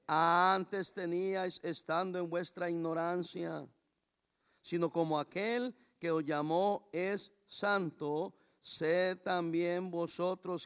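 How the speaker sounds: scripture read slowly, word by word. Shown as a demonstration. Pastor reading the verse slowly to the congregation. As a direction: read it slowly so it won't hurt. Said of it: "Se lo voy a leer despacito".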